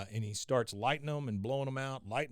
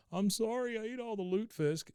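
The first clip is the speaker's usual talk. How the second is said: high announcing voice; Bobby announcing; 10 semitones higher than usual. Retold or imitated. Bobby on the toilet calling to the stranger, high. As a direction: call out an apology from the stall. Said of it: "he announces".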